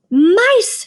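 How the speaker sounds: high, loud crowd shout. A narrator's reading. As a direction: yell it out together. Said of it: "shouted the audience".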